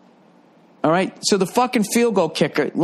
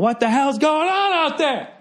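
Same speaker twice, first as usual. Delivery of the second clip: high, hammed-up old coach bellowing from the sideline; Vince Lombardi yelling; 11 semitones higher than usual. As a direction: play the furious old-school coach. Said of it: "walking up and down the sideline going".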